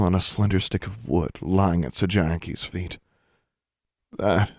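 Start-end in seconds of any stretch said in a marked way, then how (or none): 4.2-4.5 dry, cracked voice; creaky voice. A narrator's reading. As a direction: creak it out, parched. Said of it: "her voice now was a creak".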